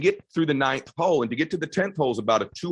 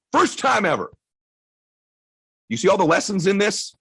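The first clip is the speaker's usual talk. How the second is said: normal talking voice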